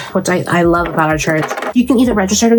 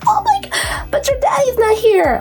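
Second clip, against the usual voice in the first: high-pitched panicked squeak; mock panic at a sudden request; squeaky flustered mom voice; flustered surprise; slower than usual.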